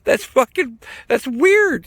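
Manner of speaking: high-pitched squirming protest; mock freaked-out protest. Patient tells nurse to stop tickling, high mock freak-out. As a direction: squeal it as if being tickled, hammed up.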